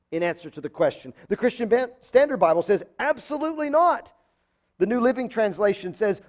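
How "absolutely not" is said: "Absolutely not" lifted high and firm; emphatic denial. Shown as a demonstration. Pastor quoting another translation, emphatic no. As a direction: ring out "Absolutely" high, land "not" hard. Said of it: "It's an emphatic denial".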